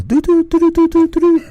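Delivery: high, rapid doo-doo beat noise; imitated beat. Guest showing his old production style, high-pitched beat imitation. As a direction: mimic a beat on one high note. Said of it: "type beats".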